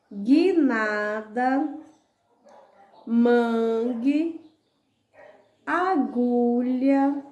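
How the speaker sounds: each word stretched out slowly with a lift at the end; slow word list read aloud. Shown as a demonstration. Teacher reading the word list slowly to the class. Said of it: "Então, lá, ó".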